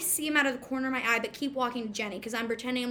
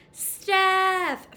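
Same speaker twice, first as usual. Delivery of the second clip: name called out high and loud; calling her name; 7 semitones higher, 6 dB louder than usual. Retold or imitated. Crush calling out her name from the queue, high loud call. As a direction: call it out across the line.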